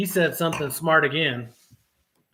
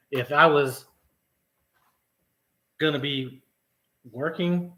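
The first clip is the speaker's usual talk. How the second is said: ordinary delivery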